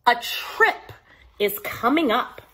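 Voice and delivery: plain reading voice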